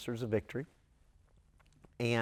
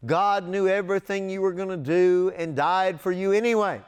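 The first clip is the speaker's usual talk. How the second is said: loud, higher, emphatic reassurance; louder and higher, insisting on every clause; emphatic insistence; 6 semitones higher, 6 dB louder than usual. Retold or imitated. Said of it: "And I would say".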